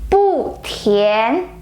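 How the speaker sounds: "Bu" falls, then "tian" rises; unchanged fourth tone. Shown as a demonstration. Teacher showing bu stays the same: falling "Bu", rising "tian". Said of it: "So the word bu also stays the".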